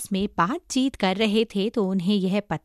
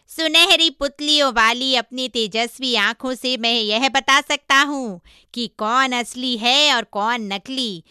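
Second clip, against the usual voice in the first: higher, loud, ringing character voice; boastful monkey disciple; 4 semitones higher, 6 dB louder than usual. Acted. Monkey telling master of his discerning eyes, boastful. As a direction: declare it loudly with confidence.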